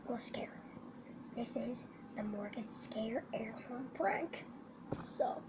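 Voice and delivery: hushed sneaky whisper. Real, not acted. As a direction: whisper so she won't hear. Sneaking up to prank sister.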